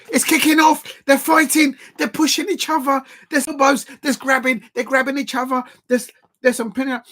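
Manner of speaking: loud, high, urgent play-by-play; excited commentary. Real, not acted. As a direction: call the scuffle as it breaks out. Commentating a brawl.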